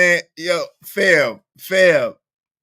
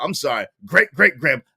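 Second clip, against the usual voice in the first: ordinary talk, nothing marked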